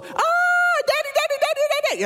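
put-on very high, loud child's panic scream; screaming for Daddy. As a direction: act out a terrified little boy shrieking for his father. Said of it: "and screaming".

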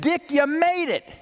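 high, ringing call across a crowd; a colleague shouting his welcome at the airport, high and elated; joyful shout. Retold or imitated. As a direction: shout it out, overjoyed to see him. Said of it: "he shouted out".

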